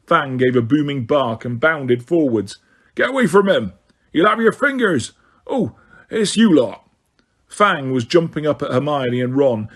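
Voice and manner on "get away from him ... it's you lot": shouting Hagrid. A narrator's reading.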